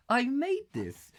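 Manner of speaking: high, slow, squeaky boast; squeaky proud voice. Pretend.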